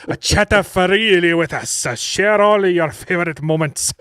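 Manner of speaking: loud, slow hammed-up Japanese accent; mock Japanese accent slipping French. Reading the dating ad, Japanese accent slipping into French. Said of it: "slipping in a little bit of a French accent".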